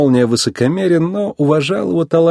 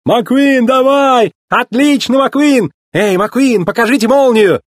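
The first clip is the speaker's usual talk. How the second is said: high, urgent shouting; excited raised-pitch hollering; clamouring press; 12 semitones higher, 3 dB louder than usual. A narrator's reading. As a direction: call out like photographers in a scrum. Journalists clamouring for a photo.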